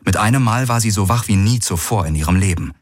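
ordinary delivery

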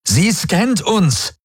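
high, slow, horrified; breathless alarm. A narrator's reading. Galen warning everyone on the bridge, breathless alarm. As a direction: realize the danger and blurt it. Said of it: "rief Galen atemlos".

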